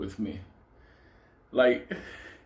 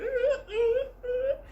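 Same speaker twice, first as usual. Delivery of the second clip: squealing burst of laughter, pitched way up; high shrieking laugh. Real, not acted. Laughing at the story.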